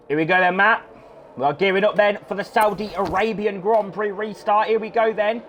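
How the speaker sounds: high, rising race build-up call; excited commentary. Real, not acted. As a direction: hype up the restart.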